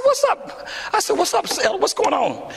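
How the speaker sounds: high, breezy greeting; casual high greeting to the elder. Retold or imitated. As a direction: toss it off carelessly. Voicing himself greeting elder.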